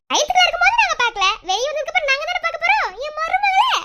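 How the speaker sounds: very high, loud cooing; playful baby talk. Acted. Aunt claims her niece, high playful baby talk.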